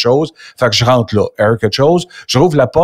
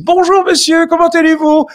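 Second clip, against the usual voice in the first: imite une voix de femme bien plus aiguë, chantante; voix aiguë de coiffeuse. Retold or imitated. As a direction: prends une voix haute et douce pour la coiffeuse. (coiffeuse qui accueille un client, voix très aiguë)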